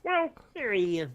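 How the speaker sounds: high, bright frog puppet voice; Kermit the Frog voice. Pretend. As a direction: squeeze it up into Kermit. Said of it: "you should have used a Kermit the Frog voice".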